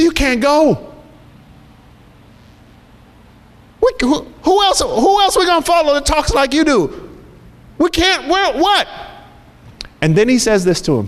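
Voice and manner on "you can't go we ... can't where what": high, pleading, desperate disciples. Acted. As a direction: beg him not to leave.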